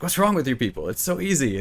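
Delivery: hammed-up frustration, pitch up; mock angry outburst. Developer storms in on the focus group, mock exasperated. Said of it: "he's so mad".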